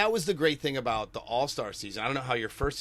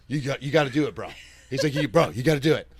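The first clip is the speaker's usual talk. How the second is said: imitating his bro partner; 2 semitones higher, 7 dB louder than usual. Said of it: "And he's like".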